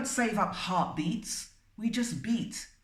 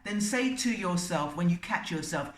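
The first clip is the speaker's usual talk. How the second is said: normal talking voice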